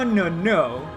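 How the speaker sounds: ordinary talk, nothing marked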